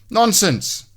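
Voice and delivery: high, brisk dismissal; Mister Tidy voice. A narrator's reading. Mister Tidy brushes off Messy, high and brisk. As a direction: wave away the objection.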